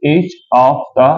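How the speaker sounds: very slow, word by word; slow dictation. Shown as a demonstration. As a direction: dictate slowly to students. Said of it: "मैंने बोल दिया बेटा".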